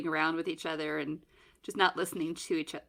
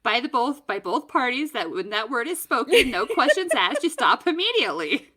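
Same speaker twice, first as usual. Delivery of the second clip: mock stern lecture; 4 semitones higher, 6 dB louder than usual. Explaining safe word to kids.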